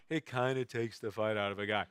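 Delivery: mildly understated tough-guy remark; deadpan understatement. Retold or imitated. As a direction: drop back to an everyday level, shrugging it off. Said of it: "and then the gross understatement".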